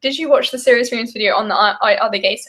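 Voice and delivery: usual speaking voice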